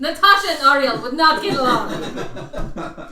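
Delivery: loud, slow laughing voice. Real, not acted. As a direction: laugh through the words. Still laughing.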